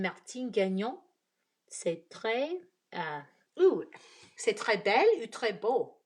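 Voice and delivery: halting, searching French accent. Shown as a demonstration. Trying a French compliment on viewer's name, French accent. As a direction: slip into French, feeling for the right word. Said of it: "don't laugh at my accent".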